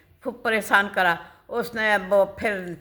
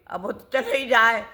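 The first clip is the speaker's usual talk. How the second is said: ordinary delivery, nothing marked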